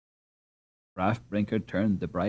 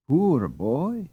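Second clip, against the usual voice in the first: tart echo; 5 semitones higher than usual. A narrator's reading. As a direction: throw "Poor boy?" back at him with a bite. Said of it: "she echoed somewhat tartly".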